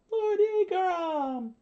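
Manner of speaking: very high, stretched-out cry; screamed out. A narrator's reading. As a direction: call the name in a slow high shriek. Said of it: "they screamed out".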